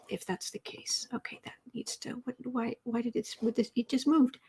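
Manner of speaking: breathy muttered whisper; whispering while fiddling with the chat; whisper to self. Real, not acted. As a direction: mutter under your breath at the screen. Muttering to herself at screen.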